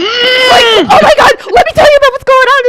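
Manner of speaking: high squealed shout breaking into laughter; mock excited livestreamer. Co-host imitating herself going live later, high mock shout. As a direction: shriek it out, hammed-up and over the top. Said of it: "You're gonna be like".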